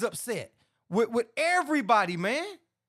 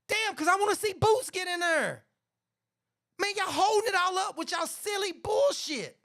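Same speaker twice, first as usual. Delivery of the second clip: frustrated rant; 9 semitones higher than usual. Real, not acted. Frustrated rant about delayed fight.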